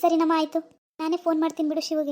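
ordinary talk, nothing marked

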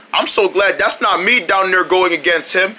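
ordinary delivery

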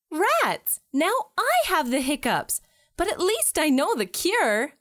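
ordinary talk, nothing marked

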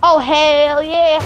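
slow, stretched West Virginian drawl; West Virginian accent. Acted. Streamer obliging chat's request in a West Virginian accent. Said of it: "Only talk in that accent".

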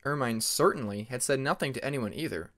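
ordinary delivery

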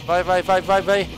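urgent panicked urging; being chased. Real, not acted. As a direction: yell at them to run, fast. Running from helicopter.

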